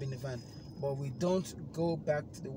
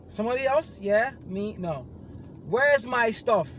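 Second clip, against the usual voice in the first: furious demand; 7 semitones higher, 9 dB louder than usual. Retold or imitated. Demanding his item back on the phone, furious. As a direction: bark it at the agent. Angry phone call to company.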